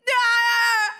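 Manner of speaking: high, loud "ah" of dismay; loud cry. Real, not acted. Reacting to gym date talk.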